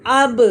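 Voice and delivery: "अब" with the b bouncing back as a short "buh"; "अब" said as "ab-uh", crisp rebound; qalqalah bounce. Shown as a demonstration. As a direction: release the b of "अब" with a little echo. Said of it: "हिलेगा कैसे?".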